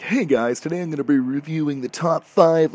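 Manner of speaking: loud, high, hyped-up YouTuber voice; fake YouTuber intro. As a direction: ham up a fake scripted intro. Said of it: "overly scripted, overly fake".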